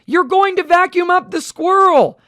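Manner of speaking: high, loud alarmed shout. Acted. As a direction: yell the warning at full voice. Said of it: "who would ever think you would shout".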